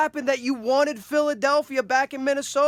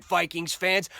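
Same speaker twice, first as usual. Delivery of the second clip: ordinary talk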